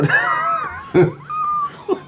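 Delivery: very high drawn-out mock shriek; mock scare scream. Pretend scare from the closet, high shriek. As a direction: shriek it like a ghost in the wardrobe. Scare sound after scare question.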